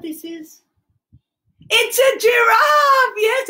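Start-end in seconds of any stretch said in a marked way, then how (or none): 1.7-3.5 loud, high, stretched-out exclamation; excited reveal. Pretend. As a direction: call it out slowly in high delight. Revealing toy giraffe to children.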